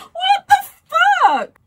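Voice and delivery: very high, slow, loud tearful wail; crying outburst. Real, not acted. Fan shocked by the lyric, tearful high wail. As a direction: wail it in disbelief through tears.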